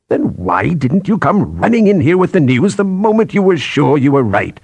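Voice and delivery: high-pitched exasperated scolding; outraged boss's question; indignant demand. Acted. Boss demands answer from subordinate.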